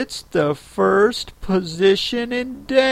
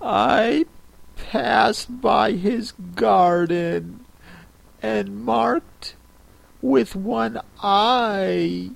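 higher, slow and softer, hesitant recital; trembling voice; 7 semitones higher, 3 dB quieter, slower than usual. A narrator's reading. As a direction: recite timidly, afraid it's coming out wrong. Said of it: "went on in a trembling voice".

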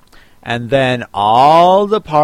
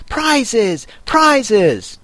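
high-pitched clamor, not shouted; confused calling out; 8 semitones higher than usual. A narrator's reading. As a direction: call out like a muddled crowd. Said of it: "calling out in a confused way".

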